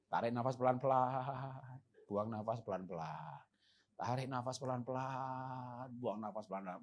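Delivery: slow, soft calming breath instructions; breathing exercise guide. Shown as a demonstration. Pastor demonstrating a breathing exercise, slow and soft. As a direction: lead them through slow breathing. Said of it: "tak ajari olah nafas".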